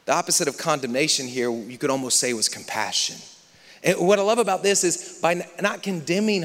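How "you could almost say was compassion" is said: normal talking voice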